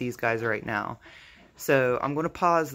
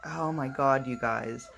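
usual speaking voice

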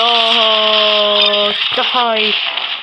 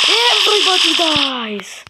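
higher, slow, drawn-out movie villain line; menacing villain catchphrase; 6 semitones higher, slower than usual. Pretend. Boy gamer to enemy team, slow higher villain voice. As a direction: announce doom to the whole lobby, slowly.

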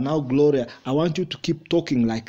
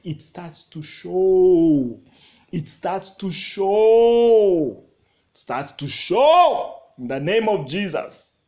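loud, drawn-out preacher's refrain; slow, swelling pulpit cry; fervent preaching; 5 semitones higher, 6 dB louder, slower than usual. Real, not acted. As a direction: stretch the words out and belt them to the congregation. Preacher drives the refrain home.

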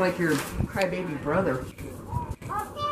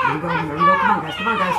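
high, loud chanting cheer; excited cheer; 5 dB louder than usual. Real, not acted. Grandma cheering her team, high and excited.

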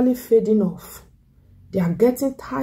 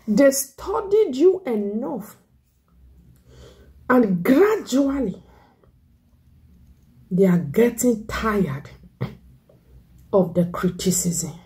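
slow emphasis; slower than usual. Acted. Slow, drawn preaching repeat.

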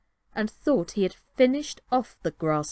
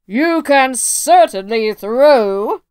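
much louder, slow and heavy; slow booming giant challenge; 5 semitones higher, 11 dB louder, much slower than usual. Acted. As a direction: boom it out like a proud giant. Giant grudgingly admits.

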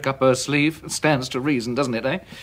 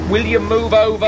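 louder and higher, fed up; exasperated bark, higher and louder; irritated shout; 9 semitones higher, 5 dB louder than usual. Acted. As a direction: shove the cat off with your voice. Snapping at the cat.

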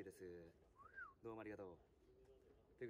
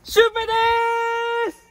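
high, loud stretched yell; full-power shout; 15 dB louder, faster than usual. Real, not acted. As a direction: shout the name across the stands. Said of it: "シュウペイですもやってみたら？".

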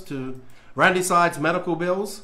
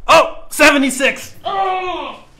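high, loud cry of dismay; dismayed shout; 12 semitones higher, 6 dB louder, much slower than usual. Real, not acted. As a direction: shout it out in disbelief. Donations push him to eat.

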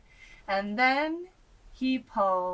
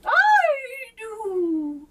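high, loud lady's voice; queen volunteering, high and loud; queen calls out; 10 dB louder than usual. A narrator's reading. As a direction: answer brightly as the queen. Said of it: "cried the queen".

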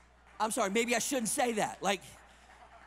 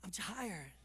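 put-on weary Southern accent, softly; quiet, mock-tired Southern accent; real Southern; 2 semitones lower, 9 dB quieter than usual. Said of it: "I'll sound real Southern".

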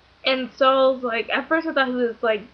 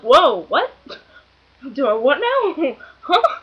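loud, startled and cracking up; retold incredulity with giggles; laughing disbelief; 3 semitones higher, 6 dB louder, slower than usual. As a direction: act out shock through laughter, pitch leaping. Retells shock at picture offer.

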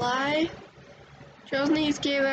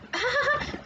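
creepy doll giggle; 9 semitones higher than usual. Acted.

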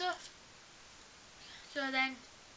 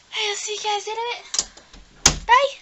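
cheery sign-off; 8 semitones higher, 13 dB louder, slower than usual. Real, not acted. Signing off cheerfully.

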